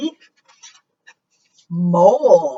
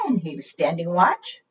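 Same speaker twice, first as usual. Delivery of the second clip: ordinary delivery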